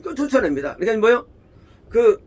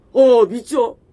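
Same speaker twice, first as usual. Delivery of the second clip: blurted out, louder; eager quick answer; 3 semitones higher, 6 dB louder, much slower than usual. Retold or imitated. Preacher acting the overeager reply to Jesus, blurted louder. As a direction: answer too quickly and confidently. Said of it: "아니에요".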